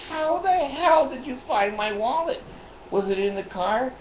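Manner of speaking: high, incredulous outburst; high-pitched how-the-hell amazement; astonished. Retold or imitated. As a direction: disbelief that it turned up. Shocked at finding the wallet.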